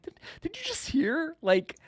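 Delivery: high, half-whispered disbelief; mock-stunned, breathy squeak of a question; can't believe they just said that; incredulous. Retelling his own stunned disbelief.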